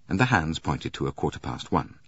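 ordinary delivery, nothing marked

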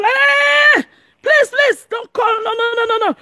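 high-pitched imitation of panicked begging; mock frantic pleading. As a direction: mimic someone begging, in a high cry. Acts out someone begging not to call.

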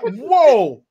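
high, loud startled whoa; hammed-up shock; startled yelp. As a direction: blurt it out in acted shock. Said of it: "And he's like".

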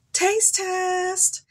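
high sing-song Taste test; playful echo. Pretend. Vlogger playfully echoing taste test for the boys, high.

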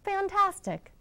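high, eager cheer; shouting. Acted. Elizabeth egging the tired dragon on, high excited cheer. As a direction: cheer him on to go again. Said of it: "but Elizabeth shouted".

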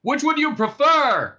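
high, loud, drawn-out question; shouting back. A narrator's reading. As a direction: holler it back at the cops. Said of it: "shouted Ford".

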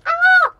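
high, loud imitated scream; short, high-pitched mock shriek; freaked-out scream. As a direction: mimic someone freaking out with a sudden yell. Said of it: "he's literally freaking out. He's like".

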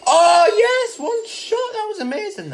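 triumphant high yell; excited. Real, not acted. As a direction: cheer the lucky shot. Celebrating a great shot.

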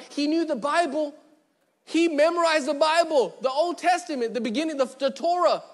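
rising preaching. Acted. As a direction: drive it up with fervour. Preaching builds on Paul's learning.

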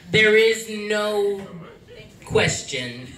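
ordinary talk

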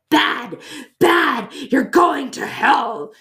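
high, loud, slow mock scolding nun; hammed-up angry nun. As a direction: shriek like a ruler-wielding nun. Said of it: "a little nun who takes your frickin' ruler".

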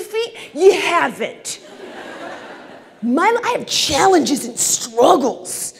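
loud, high, offended caterpillar rant; indignant caterpillar. Acted. Caterpillar protesting to snail about sixteen feet, loud offended voice. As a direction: play the caterpillar outraged at the snail.

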